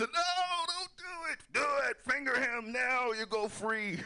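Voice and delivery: high, pushy inner voice; mock devil on the shoulder; inner voice urging. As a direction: egg him on to snitch. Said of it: "my mind just went to".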